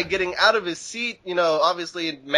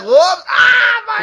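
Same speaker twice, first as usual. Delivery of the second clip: hammed-up shriek of pain, much higher and louder; mock pain yelp; 8 dB louder, slower than usual. Pretending coffee was spilled on him, mock pain shriek. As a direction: fake a yelp like hot coffee just hit you. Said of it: "be like".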